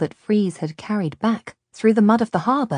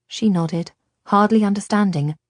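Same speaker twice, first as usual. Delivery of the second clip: usual speaking voice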